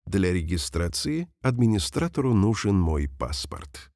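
ordinary talk, nothing marked

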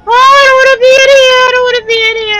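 high, loud, fast frightened pleading; panicked whine. Real, not acted. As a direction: blurt it out in terror. Trapped in room with monster.

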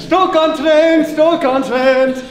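put-on very high, loud, drawn-out botched accent; British accent turned Indian. Mimicking himself shouting for friends, botched British-Indian accent. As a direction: stretch it out in British slipping into Indian. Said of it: "That's, like, Indian now".

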